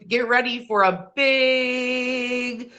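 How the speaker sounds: "big" held very long on a level note; drawn-out build-up. Real, not acted. Host teasing the dog's food costs, long drawn-out "big". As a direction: stretch out "big" as a teaser. Stretches word while seeking the next.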